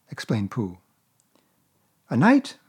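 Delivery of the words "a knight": put-on higher, brighter boy's voice asking; a small boy catching on; boyish Christopher Robin. A narrator's reading.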